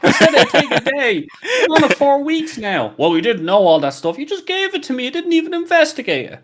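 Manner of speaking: high-pitched, hammed-up work argument; mock exasperated protest. Worker retelling a row over a dumped task, high mock exasperation. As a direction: play both sides of a squabble, voice jumping high and laughing. Acted work quarrel with boss.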